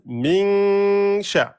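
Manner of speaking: min sha, n held two beats; two-beat noun. Shown as a demonstration. Teacher showing students the two-beat noun. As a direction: stretch the n of "min" before "sha". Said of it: "On fait deux temps sur le noun".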